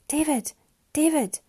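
sudden alarm. A narrator's reading. Mariana shakes motionless David's shoulder, calling in alarm. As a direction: try to jolt him awake, alarmed. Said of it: "Gripped with sudden alarm".

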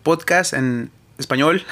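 "Podcast en español" with a Spanish accent; show-off Spanish accent on the title. Shown as a demonstration. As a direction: say it the Spanish way, rolling it out. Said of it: "you gotta say it in a Spanish accent".

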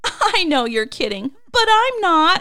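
high, loud, over-peppy retort; mock a relentlessly eager fan; Mickey Mouse Club perkiness. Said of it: "so Mickey Mouse Club right now".

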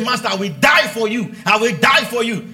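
shouting devotion to his master; shouted vow. Retold or imitated. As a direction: cry it out like a man swearing loyalty. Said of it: "He said".

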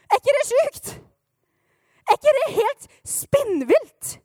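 high, loud, stretched-out astonishment; over-the-top flabbergasted yell; amazed outburst. Acted. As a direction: shout it in sheer disbelief, drawing out the end. Preacher exclaims how insane it is.

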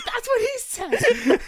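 high, loud retold exclamation through laughter; imitated outburst, slow and laughing; laughing quote. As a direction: crack up while blurting out the quote. Wife's reaction retold while laughing.

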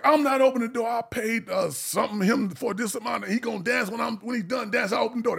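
high, stubborn defiance; defiant refusal. Retold or imitated. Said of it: "The girl's like".

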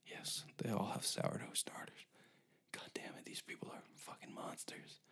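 conspiratorial whisper. Pretend. Whispering to partner about burning the place. Role-play as secret plotting.

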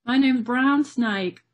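slow, put-on Aussie accent; Australian accent. Shown as a demonstration. Guest introducing herself in an attempted Australian accent. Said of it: "Can you do an Australian accent".

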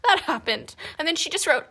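ordinary talk

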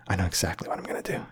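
quick, hushed plotting to himself; scheming whisper. Retold or imitated. Teen hatching an alibi plan, quick conspiratorial whisper. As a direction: whisper it fast like a secret plan.

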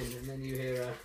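normal talking voice

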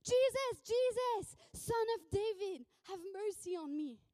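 loud, high cry for help that eases into pleading; acted scream, begging to be heard; screaming plea. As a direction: call out urgently over a crowd. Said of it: "Starts screaming".

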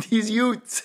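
imitating Vinny's New York Utes for youths, with a laugh; New York accent. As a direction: Utes with the th dropped, Brooklyn-style. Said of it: "with the New York accent, he was saying the Utes".

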